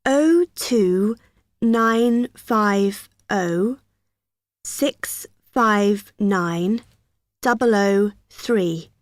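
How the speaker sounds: slow, digit by digit, like dictating a number; slow dictation. Real, not acted. Receptionist reading the Union phone number to a student, slow and deliberate. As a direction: spell each digit out slowly so he can note it.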